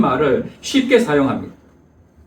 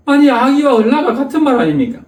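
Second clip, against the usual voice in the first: exasperated protest; 8 semitones higher than usual. Acted. Husband protesting to the principal, exasperated and higher. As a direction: throw up your hands in disbelief.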